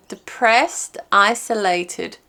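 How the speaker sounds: ordinary delivery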